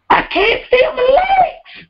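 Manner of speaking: very high, slow shouted complaint; hammed-up quarantine wail; comic wail. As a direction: squeal it out in mock agony. Comic shout about long quarantine.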